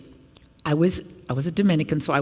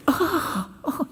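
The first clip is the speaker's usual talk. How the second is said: frightened gasp; 12 semitones higher than usual. Retold or imitated. Villagers frightened by ghost.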